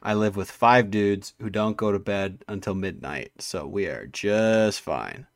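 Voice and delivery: ordinary talk, nothing marked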